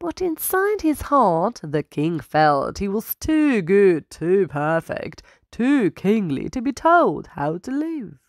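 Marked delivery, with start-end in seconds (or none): none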